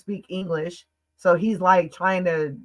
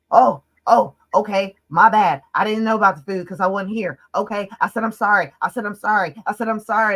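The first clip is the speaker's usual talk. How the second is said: loud, pitched-up mock apology; retold exasperated sorry, pushed out loudly; defensive apology; 3 semitones higher, 5 dB louder than usual. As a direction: imitate someone insisting they've apologized.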